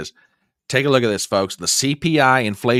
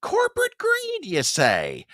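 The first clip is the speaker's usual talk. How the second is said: much higher, fake gasp of wonder; mock astonishment. Host feigning shock at the president's corporate greed line. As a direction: pretend to be shocked.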